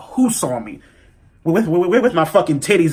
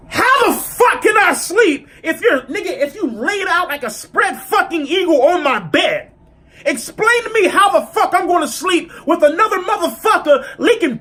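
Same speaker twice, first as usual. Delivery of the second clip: mock outraged rant; 12 semitones higher than usual. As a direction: fire it back at her, much higher and half laughing. Ranting back at the girl.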